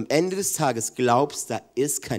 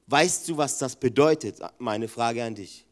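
ordinary delivery

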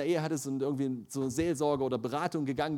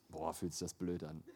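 quiet laughing groan; 10 semitones lower, 10 dB quieter than usual. Retold or imitated. Muttered while laughing.

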